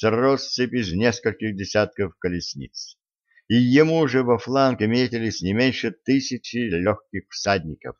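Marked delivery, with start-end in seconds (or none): none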